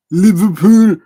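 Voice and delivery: loud, high, hammed-up Scouse; attempted Liverpool accent. Shown as a demonstration. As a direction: belt it out in a badly faked Liverpool accent. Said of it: "butchered the Liverpool accent".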